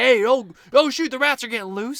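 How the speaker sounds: high, loud mock alarm; mock panic. Crew member panicking as rats escape, mock alarm. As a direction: play a flustered crew member spotting loose rats.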